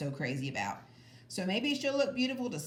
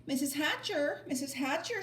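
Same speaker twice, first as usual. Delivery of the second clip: put-on higher, eager girl calling; 7 semitones higher than usual. A narrator's reading. Sheila calling to Mrs. Hatcher, higher and eager. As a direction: call out eagerly. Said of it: "Sheila called".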